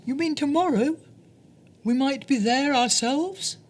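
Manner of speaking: high, eager little mouse voice; excited. Acted. Little mouse excitedly asking her friend. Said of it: "said Mouse excitedly".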